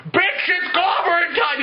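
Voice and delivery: high-pitched catchphrase yell; mock battle cry. Doctor Manhattan bursts in on villain, mock battle cry. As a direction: announce the attack, hammed-up and high.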